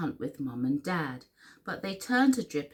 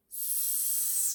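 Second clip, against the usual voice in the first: hushed, drawn-out splash; whispered splash; 14 dB quieter than usual. A narrator's reading. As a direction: stretch it out like spraying water. Acting out the splash.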